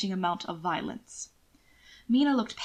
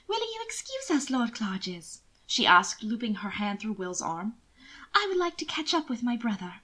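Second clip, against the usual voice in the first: lady's mock scolding. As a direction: chide him with affected sweetness. Mina excusing herself.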